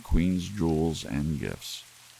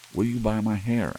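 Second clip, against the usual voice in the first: higher, pleading question; Della's eager plea; 7 semitones higher than usual. Acted. Della begging the hair buyer, higher and pleading. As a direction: ask it hopefully, reaching up in pitch. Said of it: "asked Della".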